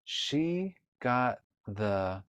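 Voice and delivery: very slow, word by word; slow reading aloud. Shown as a demonstration. Teacher modeling slow reading of a page for a child. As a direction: read it like a beginner, sounding each word. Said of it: "So let's read this page".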